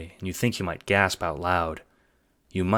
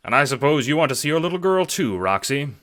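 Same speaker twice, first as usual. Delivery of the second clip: higher, louder, fast-talking pitch; eager schemer; 8 semitones higher, 5 dB louder than usual. Acted. Jacob pitching the assassination job, eager and hustling. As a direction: sell the plan like a hustler.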